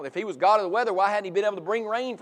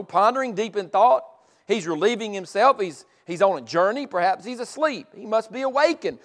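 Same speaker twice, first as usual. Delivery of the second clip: normal talking voice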